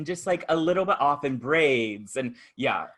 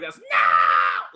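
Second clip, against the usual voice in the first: lower, louder mock shout; upset shout; 8 semitones lower, 8 dB louder than usual. Woman left behind by the bus, mock upset shout.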